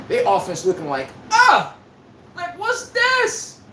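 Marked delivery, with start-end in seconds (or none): 1.3-1.8 high, loud mock yelp; mock panicked yelp. Mocking his team's offense with a yelp. 2.4-3.6 high, very slow baffled mock voice; mock confused player. Mocking his team's confused offense, drawn out and high.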